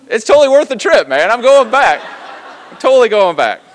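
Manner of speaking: loud, high, delighted outburst; exuberant exclamation. Real, not acted. Traveler telling the audience the cheap feast merits a return, exuberant. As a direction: belt it out to the whole room.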